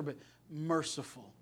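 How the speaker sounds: normal talking voice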